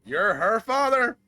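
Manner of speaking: high, loud cry of disbelief; shocked shout. Acted. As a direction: throw it out in total shock. Learns Damien is Kat's father.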